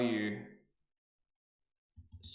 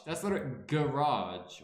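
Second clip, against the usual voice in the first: usual speaking voice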